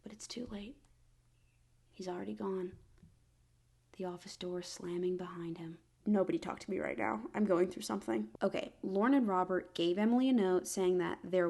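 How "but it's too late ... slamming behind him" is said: fading to a soft, dropping whisper; quiet reading. A narrator's reading. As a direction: let it die away to a hush. Sad ending of scene.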